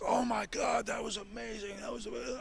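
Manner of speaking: high, hushed gushing impression; Pat Patterson. Backstage admirer praising the wrestler, gushing. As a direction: imitate a thrilled cheerleader, high and soft. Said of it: "like, my biggest cheerleader in that moment".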